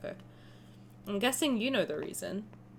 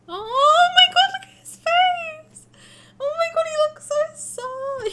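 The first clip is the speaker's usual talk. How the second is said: heartbroken squeal; 8 dB louder than usual. Real, not acted. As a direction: coo over his sad face, much higher. Reacting to sad character face.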